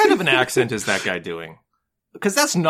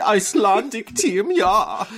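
mock Icelandic accent; 6 semitones higher, slower than usual. Mocking the fake Icelandic accent.

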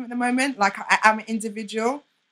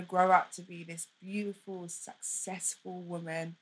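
tender, emotional; 4 semitones lower, 9 dB quieter than usual. Real, not acted. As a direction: speak gently to her younger self, holding back emotion. Tender advice to younger sister.

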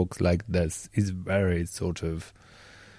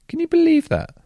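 high, loud burst of disbelief; amazed; 6 dB louder than usual. Real, not acted. Host marvelling at a friend's birthday-song trick, amazed squeal. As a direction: shoot up in amazement. Amazed at Shannon's music memory.

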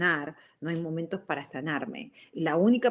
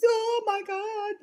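a much higher, slow, drawn-out gasp of fright; mock horror; much slower than usual. Wife describing her husband's reptile-like angry face, slow high mock horror. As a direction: stretch it out as if shocked by a monster.

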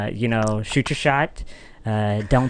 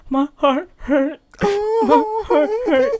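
exaggerated high-pitched sobbing wail; tearful mumble; 4 dB louder, much slower than usual. Heartbroken fourth-grader crying to his brother, hammed-up sobbing. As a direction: mimic a bawling kid, words dragged out through tears. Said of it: "but I mumbled through tears".

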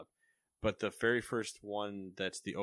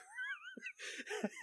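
squeaky high laugh; 8 dB quieter than usual. Real, not acted. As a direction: burst out in a high giggle. Laughs while reading the news.

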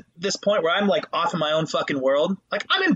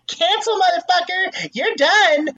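mock canceller; 10 semitones higher, 5 dB louder, slower than usual. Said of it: "so easy to just be like".

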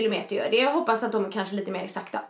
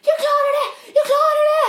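high, loud, fast shout of joy breaking into a laugh; elated shout; 7 dB louder than usual. Retold or imitated. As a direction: scream it out, overjoyed. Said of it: "Jag bara".

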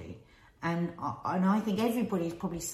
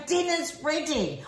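calling out dinner's ready; yelling across the room; 10 semitones higher, 3 dB louder, slower than usual. Shown as a demonstration. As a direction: shout it to the next room. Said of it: "I am always gonna shout".

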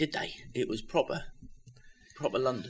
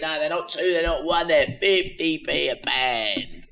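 loud, higher cockney market patter; market trader voice; 3 semitones higher, 4 dB louder than usual. Pretend. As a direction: hawk fruit like a London barrow boy. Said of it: "do it as if you were Del Boy".

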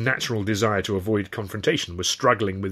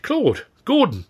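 high, loud exclaimed names; startled, high-pitched greeting; astonished cry; 13 semitones higher, 6 dB louder, slower than usual. A narrator's reading. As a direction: call out each name in surprise. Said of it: "Stan cried".

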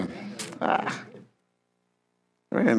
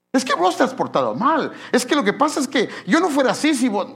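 accusing reply; 12 semitones higher, 4 dB louder than usual. Acted. Acted quarrel blaming.

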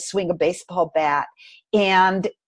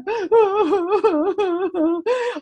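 laughing cry; 11 semitones higher than usual. Real, not acted. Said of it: "self-imposed pity party".

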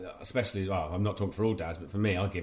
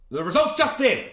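high, much louder announcer call; mock announcer; 14 semitones higher, 10 dB louder than usual. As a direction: trumpet it like breaking news. Announcing poll results.

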